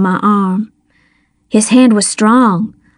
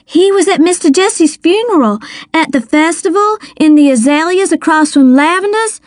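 high, urgent, insistent protest; voice rising; 7 semitones higher, faster than usual. A narrator's reading. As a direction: pile it up in a high, agitated voice. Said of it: "my voice rising".

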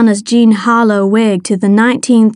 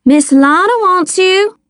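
high, sing-out call rising at the end; 9 semitones higher than usual. A narrator's reading. Girl fakes summoning the colonel, calling out. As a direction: call out the door for show. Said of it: "I called so Star could hear me".

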